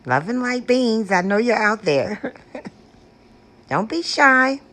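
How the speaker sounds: ordinary talk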